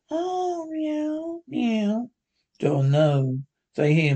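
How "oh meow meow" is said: high, soft, sleepy cat mews; drowsy high kitty meows; waking cat meows. Acted. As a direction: mew like a cat just waking.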